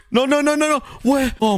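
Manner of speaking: high panicked cries of no, half laughing; startled panic. Real, not acted. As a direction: yelp in real fright at the creeper. Creeper jump-scare panic.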